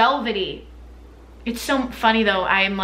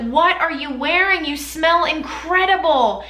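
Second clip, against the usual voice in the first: excited gush; 6 semitones higher than usual. Retold or imitated. Telling her aunt she smells amazing, excited and high. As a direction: swoon over the scent, voice up high. Gushing at aunt's perfume.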